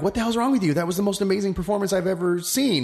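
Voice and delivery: imitating amazed colleagues, pitched high; incredulous retelling. Colleagues confronting a great actor after his show, incredulous.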